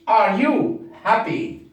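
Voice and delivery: are you happy, slow; slow question drill; slow question model. Shown as a demonstration. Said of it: "modelling question".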